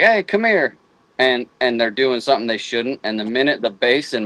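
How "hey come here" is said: high-pitched call to a dog. Retold or imitated. Hunter calling his dogs over while out hunting, high-pitched call. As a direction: call them over, voice swooping up. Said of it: "I'll be like".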